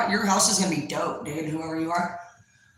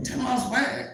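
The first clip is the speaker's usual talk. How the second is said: soft, higher, questioning echo; taken-aback small voice; 4 semitones higher, 5 dB quieter than usual. Retold or imitated. Newcomer questioning the boss's order, small doubtful voice.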